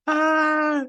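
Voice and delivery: high laughing squeal of delight; real giddy laugh. Real, not acted. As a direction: let a happy laugh burst out. Laughs, happy cry.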